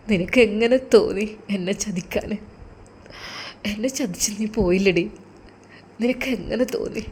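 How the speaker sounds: soft, choked voice of a betrayed husband; sobbing reproach. Acted. Husband speaking to his wife's photo, softly choked with grief. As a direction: hold back tears as you blame her. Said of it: "അവൻ വിങ്ങിപ്പൊട്ടി".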